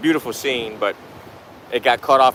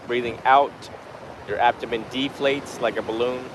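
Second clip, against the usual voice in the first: guided meditation; 6 dB quieter than usual. Real, not acted. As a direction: guide the breath unhurriedly, phrase by phrase. Leads a breathing meditation.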